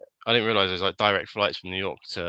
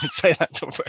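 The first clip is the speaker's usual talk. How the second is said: laughing; 9 semitones higher, 6 dB louder than usual. Acted. Mock order while laughing.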